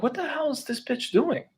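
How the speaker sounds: high, hushed disbelief; soft incredulous squeak; retold bafflement. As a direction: think aloud in baffled falsetto. Baffled inner reaction to neighbor.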